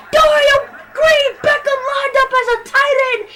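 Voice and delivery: high, loud burst calling the play; yelling with excitement at the formation; shouted play-by-play; excited shout. Real, not acted.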